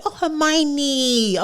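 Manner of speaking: drawn-out, very slow "Hermione"; dawning realisation. Acted. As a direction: stretch out "Hermione" softly, as if finally getting it. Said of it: "watch the movie and say".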